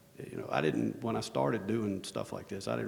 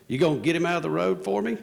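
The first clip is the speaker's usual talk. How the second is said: put-on higher, louder old-timer calling out; old man on porch; 8 semitones higher, 8 dB louder than usual. Neighbor on his porch calls out, louder and higher. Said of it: "and he goes".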